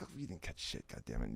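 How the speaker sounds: soft, low under-the-breath grumble; muttered. Retold or imitated. Her muttered comeback.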